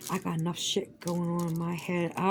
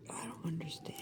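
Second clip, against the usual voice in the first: much softer, breathy whisper; 13 dB quieter, faster than usual. Real, not acted. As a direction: drop to a bewildered whisper. Repeats phrase, then whispers it.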